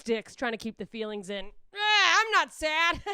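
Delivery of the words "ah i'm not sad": mock crying. Comic to the audience, fake crying. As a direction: fake sob, slow and stretched.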